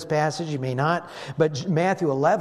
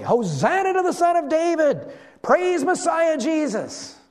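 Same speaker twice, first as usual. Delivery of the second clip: high joyful shout, much louder; shouting loudly; 11 semitones higher, 5 dB louder than usual. Pretend. Children crying out praise in the temple, joyful loud shout. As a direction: cry it out like excited kids in the temple. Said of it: "it carries the idea of shouting loudly".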